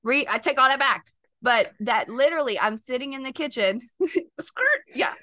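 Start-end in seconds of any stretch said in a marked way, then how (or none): 4.4-4.8 high, loud comic "skrrt" screech; playful screech noise. Pretend. Breaking off the kitchen story with laughter, playful screech. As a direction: squeal it like skidding tyres.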